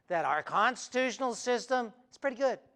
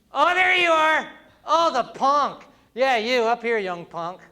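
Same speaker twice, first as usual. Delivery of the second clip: loud, higher, playful call into the crowd; 4 semitones higher, 6 dB louder than usual. Real, not acted. Speaker calls the young punk up on stage, loud and teasing.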